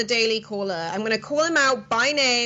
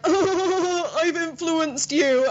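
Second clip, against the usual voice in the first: higher-pitched mock cooing impression; imitating boyfriend; 5 semitones higher, much slower than usual. Telling host of boyfriend's reaction, imitated in higher voice. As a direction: tease like a pleased boyfriend, drawn out. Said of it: "he was more like".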